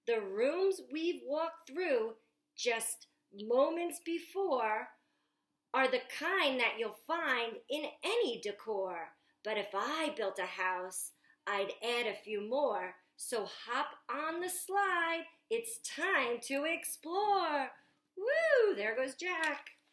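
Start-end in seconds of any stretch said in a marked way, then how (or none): 0.0-17.7 put-on lively picture book read aloud to children; storytime reading. Reads Jack's lines aloud. 18.2-18.7 high, loud joyful woo down a slide; excited whoop. Acted. Jack shoots down the slide.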